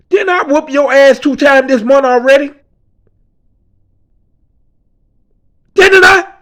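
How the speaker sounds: high, loud and menacing, rising to a yell on the second Didn't; angry gang enforcer. Retold or imitated. Gang enforcer to the cowering boy: high, loud, menacing yell. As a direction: bark it down at him like a bully cornering his victim. Enforcer threatening the speaker in hallway.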